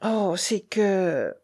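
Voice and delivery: slow, hesitant and fearful; trembling woman. A narrator's reading. Rochaine hesitates to confide in the baron, trembling. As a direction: draw it out, afraid to go on. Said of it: "dit Rochaine tremblante".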